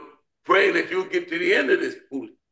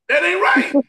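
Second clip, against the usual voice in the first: emphatic; 9 semitones higher, 6 dB louder than usual. Real, not acted. Emphatic punchline to the group.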